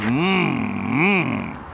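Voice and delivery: low humming mm like a purring cat; car purring like a cat on catnip; purring engine. Retold or imitated. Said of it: "She purrs".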